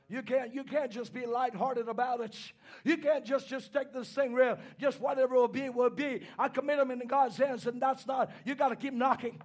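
ordinary talk

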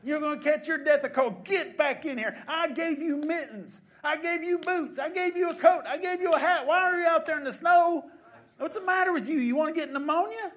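loud, high-pitched hollering mother; scolding mom. Acted. Mom to children out in the snow without coats, shouted scolding. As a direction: holler at the kids like an alarmed mom. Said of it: "But the mom would be like".

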